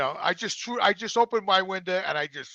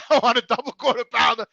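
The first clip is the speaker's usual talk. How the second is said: louder, higher exasperated list; frustrated customer repeating the order louder; 6 semitones higher, 5 dB louder than usual. Retold or imitated. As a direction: hammer every no, raising the voice. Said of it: "I said".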